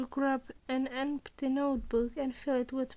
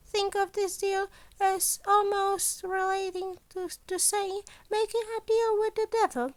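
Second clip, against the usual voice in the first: higher, brighter little principal voice; a scheming principal, a touch higher and louder; Nezu's voice; 7 semitones higher, 3 dB louder than usual. A narrator's reading. Principal Nezu explaining his deal, higher and louder.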